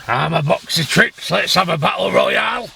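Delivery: lightly voiced tough-guy boast ending in a shout; mock John Fury. Vlogger mocks Big John Fury's challenge, hammed-up shouted boast. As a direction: mimic a blustering fighter's challenge. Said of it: "who Big John means".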